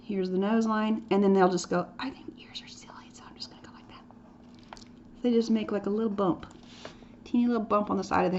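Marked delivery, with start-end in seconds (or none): 2.0-4.0 whispered, playing the careless student; mock student whisper. Teacher voicing a student who skips ears, in a whisper. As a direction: breathe it like a kid shrugging off ears.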